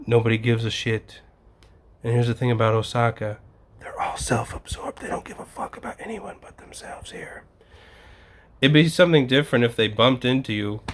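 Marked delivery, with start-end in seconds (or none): 3.8-7.4 conspiratorial whisper. Real, not acted. Confiding a jab about Osaka locals, hushed whisper. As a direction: lean in and whisper the insult.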